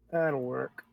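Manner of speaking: ordinary talk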